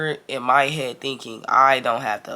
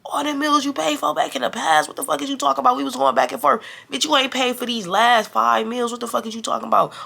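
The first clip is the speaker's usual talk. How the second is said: high-pitched, incredulous retold argument; indignant comeback; 9 semitones higher than usual. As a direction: throw her claim right back at her. Throws friend's claim back, arguing.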